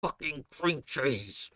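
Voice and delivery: low, slow, soft grumbling cat; low slow offended cat mutter; grumpy cat voice. Retold or imitated. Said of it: "it's like".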